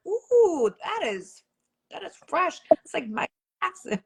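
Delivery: put-on high, delighted gushing at a kid's dance move; mock impressed mom. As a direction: ooh and ahh like a proud mom showing off. Mom praises son's dance move.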